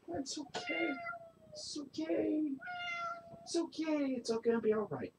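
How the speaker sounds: high, soft cooing to a scared pet; soothing cooing. Real, not acted. Owner soothing her nervous cat, high soft coo. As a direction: coo reassurance up high and gently.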